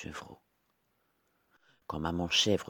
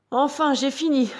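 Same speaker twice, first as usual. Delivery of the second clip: high, loud little pig with mild weariness; tired little pig; 8 dB louder than usual. A narrator's reading. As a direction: pipe up as a pig finishing hard work. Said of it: "dit Corto fatigué". (petit cochon fatigué, voix aiguë)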